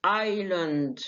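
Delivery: آيلاند with no s; island said as آيلاند; silent s. Shown as a demonstration. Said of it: "مفيش إس".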